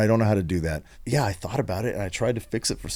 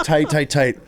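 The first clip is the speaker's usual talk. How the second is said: normal talking voice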